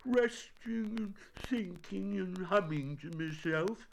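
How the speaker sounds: high, slow, airy bear voice; careless. A narrator's reading. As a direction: play nonchalant, high and drawn out. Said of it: "said Winnie the Pooh carelessly".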